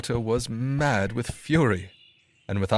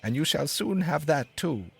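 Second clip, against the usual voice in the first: higher bird voice; sparrow; 6 semitones higher than usual. A narrator's reading. Sparrow leading the dog to bread, higher bird voice. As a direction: beckon as the sparrow. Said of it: "said the sparrow".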